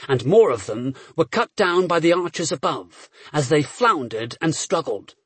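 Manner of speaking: plain reading voice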